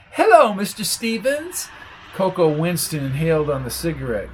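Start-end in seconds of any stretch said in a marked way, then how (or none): 0.1-1.7 high squeaky greeting. A narrator's reading.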